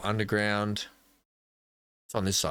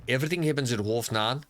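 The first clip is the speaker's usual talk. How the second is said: amateur go at a South African accent. Shown as a demonstration. Player keeps trying the phrase, South African accent. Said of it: "I'm always gonna keep trying".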